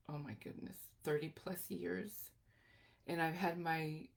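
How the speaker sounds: usual speaking voice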